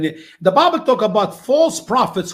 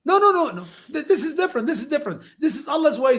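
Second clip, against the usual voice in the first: flustered lady's voice, much higher; voicing the lady; 9 semitones higher, faster than usual. Lady unable to see the difference, pitched high. Retold talk with Iranian lady.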